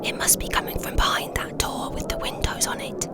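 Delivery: soft, breath-only whisper; hushed stage whisper. Acted. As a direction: whisper it to your companions. Sneaking, locating a sound.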